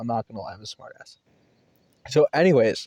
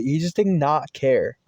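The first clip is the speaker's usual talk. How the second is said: ordinary delivery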